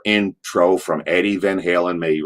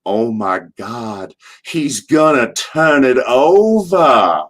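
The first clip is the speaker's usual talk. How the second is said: Dusty Rhodes voice; 4 semitones higher, much slower than usual. Retold or imitated. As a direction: drag it out like the old legend, scared of a crash. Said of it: "he goes".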